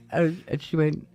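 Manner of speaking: ordinary talk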